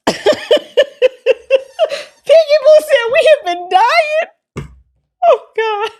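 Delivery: high squeal through real laughter; helpless laughing. Real, not acted. Streamer reading a chat comment, high and helpless with laughter. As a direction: read it out while cracking up, pitch way up.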